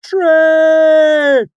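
a loud, high call from far down the line; distant shout. Acted. A troupe member shouting a warning down the line, loud and distant. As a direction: shout the warning back along the wagons. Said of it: "The shout came faintly down the line".

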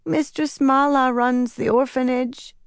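put-on small, high, feeble boy's voice; a sick little boy speaking weakly; weak child voice. A narrator's reading. Sick orphan boy tells Nynaeve who runs the orphanage, weak and high. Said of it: "the child said in a weak voice".

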